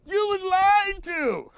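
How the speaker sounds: high, slow, through real laughter; laughing. Real, not acted. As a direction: crack up while saying it slowly. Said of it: "I don't know why that's so funny to me".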